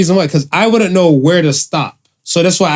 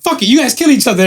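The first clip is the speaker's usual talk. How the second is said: mock fed-up mother; 8 semitones higher, faster than usual. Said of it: "I said".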